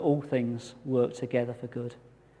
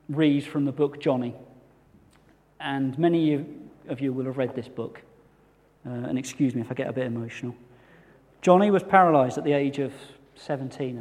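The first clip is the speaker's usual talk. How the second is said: ordinary talk, nothing marked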